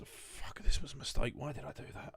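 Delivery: hushed, tearful muttering; mock tearful. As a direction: play at breaking into tears, under your breath. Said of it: "breaks into tears".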